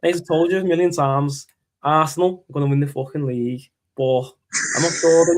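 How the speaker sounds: thick put-on Liverpool accent; Carragher. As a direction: do a comic Scouse football pundit. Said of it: "You could do a good Carragher".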